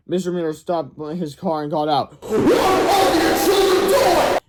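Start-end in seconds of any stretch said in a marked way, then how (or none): 2.2-4.4 loud, high, drawn-out yell. Acted. Mister Meter yelling at the working kids, loud and slow. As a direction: bellow it across the yard.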